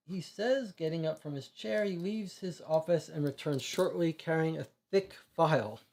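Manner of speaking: ordinary talk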